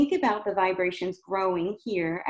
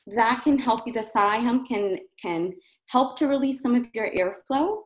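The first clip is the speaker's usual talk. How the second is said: normal talking voice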